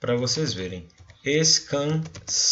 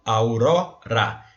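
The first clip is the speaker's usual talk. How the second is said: "aurora" slow with ró stressed; 3 semitones lower, much slower than usual. Shown as a demonstration. Teacher to class, stressing ró in "aurora". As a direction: lean on the ró of "aurora". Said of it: "a sílaba tônica é o ró".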